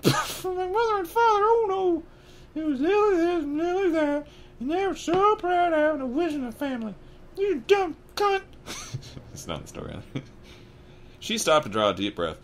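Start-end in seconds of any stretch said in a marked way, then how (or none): 0.0-8.4 very high, strained falsetto tirade; ranting aunt. Acted. Aunt ranting about her sister, in a high screechy rant. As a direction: rant bitterly in a piercing high-pitched voice. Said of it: "then went ranting on".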